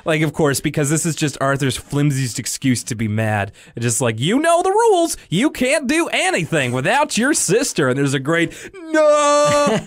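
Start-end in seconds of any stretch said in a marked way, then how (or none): 4.2-7.9 high-pitched cartoon rule-enforcer; hammed-up high voice laying down the law; mock scolding. Acted scolding from mission control. 8.7-9.8 high, drawn-out mock wail; hammed-up cartoon cry of despair; dramatic "No!". Arthur's dramatic overhead cry.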